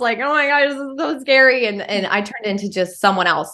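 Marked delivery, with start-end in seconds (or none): 0.2-1.7 imitating scared child. Voicing her scared five-year-old.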